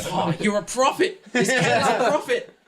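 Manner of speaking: high, loud exultant cry; awed jubilation. Acted. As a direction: proclaim it like a true believer. Yuri hails the cat.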